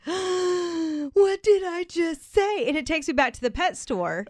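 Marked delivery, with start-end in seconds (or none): 0.0-2.7 horrified realization. Retold or imitated. Retelling her own embarrassed realization.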